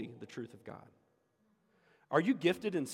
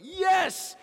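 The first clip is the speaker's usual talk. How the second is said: excited yes; 8 dB louder than usual. Retold or imitated. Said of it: "And we're like".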